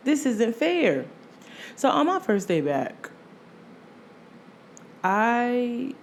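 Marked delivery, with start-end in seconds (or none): none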